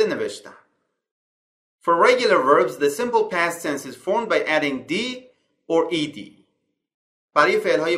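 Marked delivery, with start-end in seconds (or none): 1.8-6.2 slow, careful reading of an English grammar rule. Acted. Teacher reading the slide rule to students, slow and clear. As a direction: read the rule out slowly and clearly, word by word.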